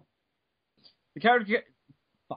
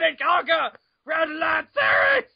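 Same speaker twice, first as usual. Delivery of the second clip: dramatic shout; 6 semitones higher, slower than usual. Pretend. Reading a wiki page, hammed-up shout. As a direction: proclaim it like a trailer. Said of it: "over-dramatized voice".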